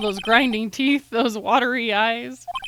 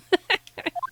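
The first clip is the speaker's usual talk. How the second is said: high character chuckle; 9 semitones higher than usual. Acted. Character laugh voiced.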